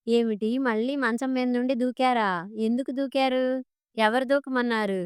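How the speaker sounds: ordinary delivery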